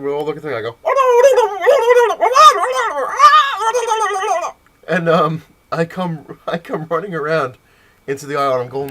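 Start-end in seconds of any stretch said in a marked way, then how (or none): 0.8-4.5 long, high warbling gobble and shriek; loud prank noise. Retold or imitated. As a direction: let a wild trill run on at full volume. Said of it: "like, really loud".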